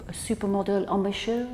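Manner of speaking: a playful hint of a French accent; mock French accent. Shown as a demonstration. Flute teacher showing students a soft mock French accent. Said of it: "try it with a French accent".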